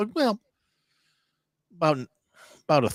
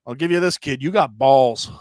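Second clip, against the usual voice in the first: raised, emphatic imitation of the boss; old chef's grudging praise; 5 semitones higher, 9 dB louder than usual. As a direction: concede it as the old chef, loud and emphatic at the end. Chef grudgingly praises young cook.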